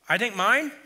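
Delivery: ordinary delivery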